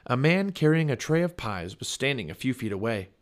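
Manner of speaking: usual speaking voice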